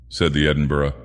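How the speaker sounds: ordinary delivery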